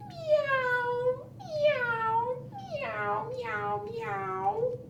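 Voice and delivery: high, rising kitten meow; playful high kitten mewing. Acted. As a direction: meow like a little kitten for the kids. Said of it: "And what do they say?".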